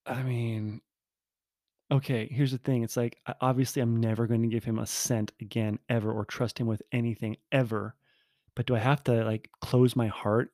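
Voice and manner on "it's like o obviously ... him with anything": ordinary delivery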